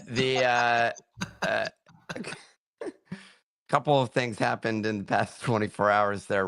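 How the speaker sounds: usual speaking voice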